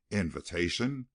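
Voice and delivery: ordinary delivery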